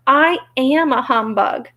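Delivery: pleased admission. Acted. Little man confesses to the Scarecrow, pleased. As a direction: own up slowly and happily. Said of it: "as if it pleased him".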